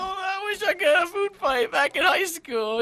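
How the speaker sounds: lightly mocking, high-voiced impression; mock wistful. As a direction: pitch it up to tease a friend's daydream. Mocking friend's food fight wish.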